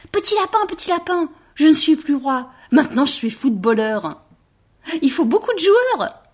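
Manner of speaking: high, eager rabbit voice; excited rabbit. Acted. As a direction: invite them all to play. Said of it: "il ajouta".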